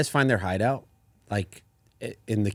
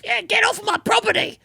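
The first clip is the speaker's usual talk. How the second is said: squeaky, much higher mock critter snarl; angry squirrel. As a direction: shoo them off like a tiny territorial squirrel. Hostile squirrel attacks player.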